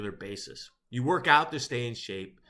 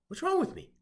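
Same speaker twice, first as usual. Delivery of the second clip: bewildered question; 14 semitones higher than usual. Acted. Bewildered self-question, big pitch jump.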